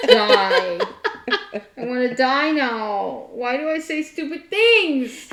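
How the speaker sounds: loud, slow, drawn-out mock wail; high, overdone cry of embarrassment; mock despair. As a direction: lament your own blunder, hammed up. Acting out her embarrassment.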